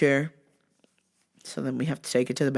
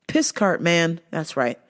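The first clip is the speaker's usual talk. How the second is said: ordinary delivery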